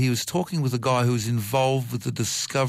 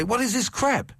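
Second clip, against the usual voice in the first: much higher-pitched outraged moviegoer; mock indignation; 11 semitones higher than usual. Doctor imitates moviegoers suddenly rejecting the movie, mock indignant. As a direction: act out sudden disbelief at the film. Audience's sudden disgusted reaction acted out.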